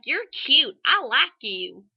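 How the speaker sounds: loud, high, drawn-out grandma impression; imitated grandma. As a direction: imitate a grandma gushing at a stranger. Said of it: "my grandma decides to go".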